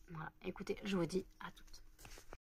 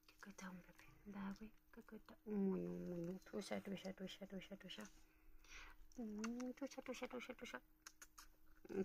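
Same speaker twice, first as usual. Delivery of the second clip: tender pet cooing; 15 dB quieter than usual. Real, not acted. Fussing over the pet, tender and hushed. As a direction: coo it under your breath, drawing out the repeats.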